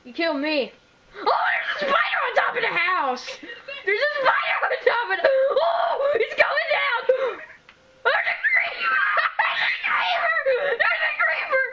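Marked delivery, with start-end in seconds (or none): 1.2-7.0 high laughing scream; screaming at a spider. Real, not acted. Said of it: "Scared of this or something?". 8.1-11.6 very high laughing shriek; screaming. Real, not acted. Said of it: "we're screaming 'cause it's fun".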